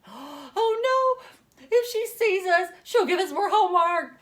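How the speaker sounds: put-on high, loud panicked gasp of kids; scared kids. Kids spot the witch teacher, panicked and loud. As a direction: squeal it like frightened children.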